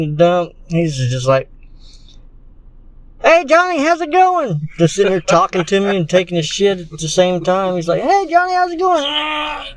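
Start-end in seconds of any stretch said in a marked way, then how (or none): 3.2-4.7 falsetto friendly hey; chirpy high greeting voice; high-pitched casual greeting. Retold or imitated. Mimicking the guy on the toilet. 8.0-9.7 falsetto friendly hey; chirpy high greeting voice; high-pitched casual greeting. Retold or imitated. Mimicking the guy on the toilet.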